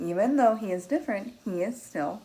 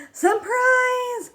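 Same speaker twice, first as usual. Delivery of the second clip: high, loud, excited shout; Spike's shout; 7 dB louder than usual. Acted. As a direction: burst out the surprise as playful Spike. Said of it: "says Spike".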